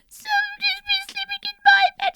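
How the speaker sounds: put-on very high fast squeaky baby bear; very high rushing baby voice. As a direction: squeak it like a tiny cub. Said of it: "said Baby Bear".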